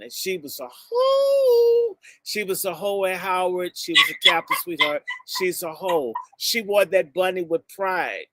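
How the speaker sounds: ordinary delivery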